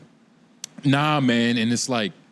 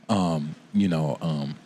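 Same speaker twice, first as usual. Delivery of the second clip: sultry voice; 9 semitones lower than usual. Retold or imitated. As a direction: breathe it out low and sultry. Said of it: "this sultry ass voice".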